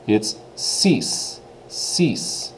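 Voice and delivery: "cease" with a long ee and a drawn-out final s; French "cease" for the number six. Shown as a demonstration. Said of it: "Pronunciation is a little bit different".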